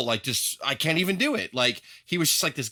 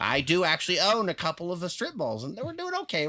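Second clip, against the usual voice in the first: mild Vader voice; 5 semitones higher than usual. Retold or imitated. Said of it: "he was just like".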